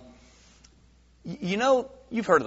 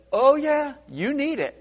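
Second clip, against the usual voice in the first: much higher, laughing mobster insisting; a menacing chuckle behind "you need it"; mob insistence; 12 semitones higher than usual. Acted.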